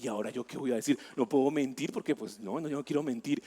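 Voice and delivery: anxious thought under the breath; nervous inner voice. Retold or imitated. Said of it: "Y yo me puse nervioso". (voz interior nerviosa y baja)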